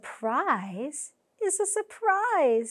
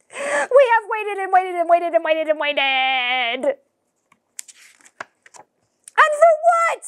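frustrated outburst; 6 semitones higher, 6 dB louder than usual. Acted. Frustrated outburst after long wait.

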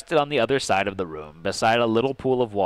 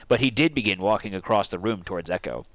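ordinary talk, nothing marked

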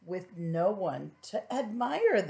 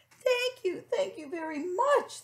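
high bubbly little fish; squeaky delighted little fish; playful thanks; 12 semitones higher than usual. Acted. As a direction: chirp your thanks playfully. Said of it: "bubbled playfully".

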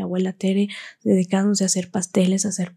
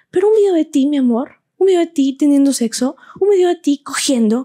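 mother's voice; 6 semitones higher, 4 dB louder than usual. Retold or imitated. Mother's emphatic voiced speech.

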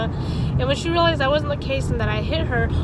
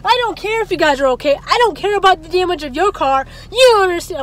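louder mocking of a furious mother; angry mother; 5 semitones higher, 6 dB louder than usual. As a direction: throw it back as the mother's angry refusal. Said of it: "She was like".

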